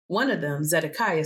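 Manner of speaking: ordinary delivery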